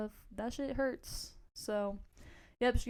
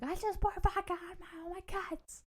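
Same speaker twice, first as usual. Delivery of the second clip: high, strained mock distress; frantic imitation; 10 semitones higher than usual. Friend reporting back on vodka in cuts, frantic imitation. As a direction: put on a high, worked-up voice.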